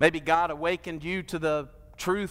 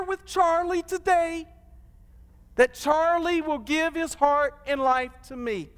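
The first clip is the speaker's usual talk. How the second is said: excited retelling; 10 semitones higher than usual. Retold or imitated. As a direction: tell it like you can't believe it, climbing high. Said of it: "And I was so excited".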